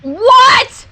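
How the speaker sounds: stunned shout at the twist; shocked shout. Real, not acted. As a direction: yell it in disbelief.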